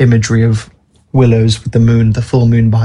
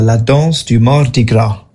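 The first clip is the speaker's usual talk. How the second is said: Cajun French accent. Shown as a demonstration. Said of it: "I'll try and say this in a Cajun French accent".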